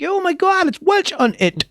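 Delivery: high-pitched mock astonished exclamation; exaggerated high-voiced "oh my God" reaction; mock amazement. Mock quote of an amazed onlooker.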